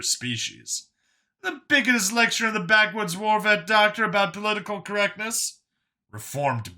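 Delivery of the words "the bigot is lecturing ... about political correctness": high-pitched, scoffing character voice; Brian's mocking voice. Brian mocks Vincent in dialogue.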